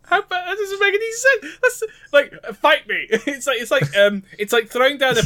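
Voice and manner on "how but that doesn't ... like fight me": very high indignant squeal; high exasperation. Acted. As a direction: squeal it in disbelief.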